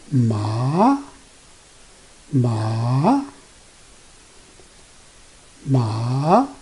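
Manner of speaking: "ma" drawn out, rising from low to high; second tone. Shown as a demonstration. Teacher showing the second Chinese tone, "ma" rising low to high. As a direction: start "ma" low and sweep it upward. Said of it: "Start from low to high".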